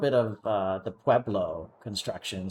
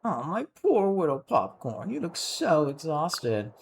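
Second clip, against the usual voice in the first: Camilla; 3 semitones higher than usual. Acted. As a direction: coo over a tired little sibling. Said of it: "Camilla, but I'll survive".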